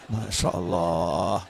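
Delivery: long sigh. Acted. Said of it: "desah panjang".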